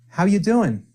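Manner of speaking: "How you doing" run together fast and loose; quick casual contraction. Shown as a demonstration. Teacher shows the casual quick form to learners, fast and relaxed. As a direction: clip it short, drop the "are". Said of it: "you can just say very quickly like this".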